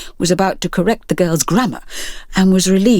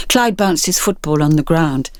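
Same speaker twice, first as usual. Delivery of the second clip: plain reading voice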